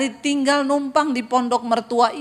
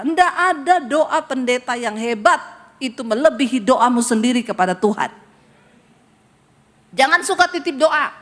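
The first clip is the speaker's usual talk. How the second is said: usual speaking voice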